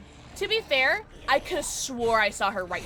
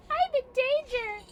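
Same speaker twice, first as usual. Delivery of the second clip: put-on high cute anime girl; 13 semitones higher than usual. Meme line in high voice.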